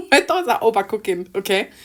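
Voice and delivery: loud, high, through giggles; flustered, laughing outburst; laughing at oneself. Real, not acted. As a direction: crack up mid-sentence. Laughing at own muddled thoughts.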